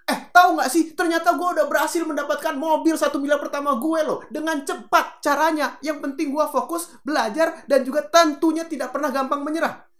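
over-the-top motivational yelling; exaggerated shouting. Shown as a demonstration. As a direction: shout it all on one high note, like a hype speaker. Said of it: "Kalau ngomongnya cuma teriak-teriak doang".

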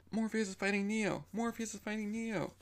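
high, urgent shouting of the news; excited shout. Retold or imitated. Mouse running in, shouting news.

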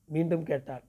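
ordinary delivery, nothing marked

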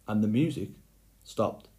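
ordinary delivery, nothing marked

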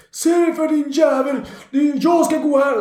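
high, slow angry yell at a stranger; angry old man. Retold or imitated. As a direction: snap at her like a grumpy old man. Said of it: "blir han jättearg bara på mig". (arg gammal man som skäller)

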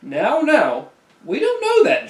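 put-on lightly quavering old man voice; quavery voice. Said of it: "his quivering voice".

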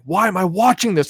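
high, exasperated complaint; frustrated. Retold or imitated. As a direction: whine at the screen in disbelief. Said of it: "I'm like".

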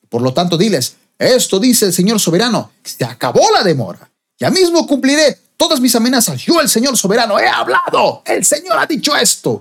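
emphatic reading climbing to a high cry; prophetic scripture proclamation. Acted. Preacher voices the Sovereign Lord's warning, climbing prophetic proclamation. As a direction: build to God's high, final declaration.

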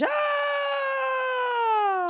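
sudden high yelp of a man going over the edge; short high mock fall cry; falling scream. Character falls off bridge.

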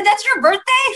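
high, amazed disbelief; retold surprise at a shared birthday; surprised question. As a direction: ask it in sudden astonishment.

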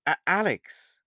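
startled recognition, voice lifting; genuine surprise. A narrator's reading. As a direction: caught off guard by seeing him. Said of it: "with genuine surprise".

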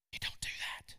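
hushed whispered repeat; preacher whispering the warning back. Shown as a demonstration. Preacher contrasts shout and whisper.

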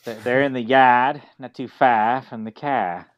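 slow Boston accent demo; put-on Boston accent. Shown as a demonstration. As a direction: drop the r's like a Bostonian. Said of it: "if he can do a Boston accent".

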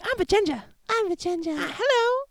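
put-on very high falsetto girl voice; girly falsetto. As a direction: squeak it like a teen girl. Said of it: "Vajenja's all like".

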